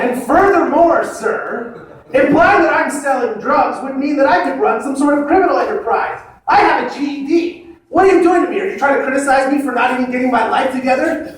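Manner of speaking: high, offended tirade that breaks into laughter; indignant rant. Retold or imitated. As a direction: play the wounded stoner demanding respect.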